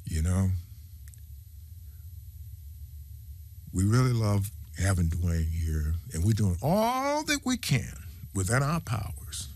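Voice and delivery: low, measured official spiel with long pauses; mock public statement. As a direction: parody team bosses professing goodwill. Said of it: "come right in front of the public and say".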